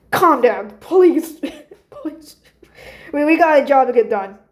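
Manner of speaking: loud exasperated plea breaking into laughter; mock exasperation. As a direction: playfully tell someone off to calm down. [name] exasperated at Cheddar, acted.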